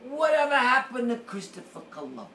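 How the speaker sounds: high, loud, hammed-up roast shout; impersonating a roaster shouting at the dais. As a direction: belt it out like a roast comic heckling. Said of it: "he comes up and he goes".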